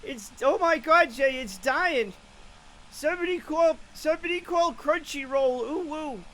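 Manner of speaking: high, panicked Boston accent; hammed-up Boston fisherman in alarm. Shown as a demonstration. Streamer as fisherman over a dying whale, Boston accent. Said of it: "Maybe I'll go Boston".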